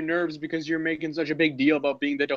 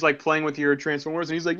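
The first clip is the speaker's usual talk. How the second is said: ordinary talk, nothing marked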